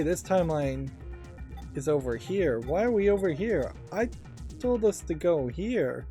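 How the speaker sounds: normal talking voice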